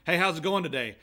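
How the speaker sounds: lightly voiced regular guy; normal guy. Normal guy on the line greeting caller, everyday voice. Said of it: "just got a normal guy being like".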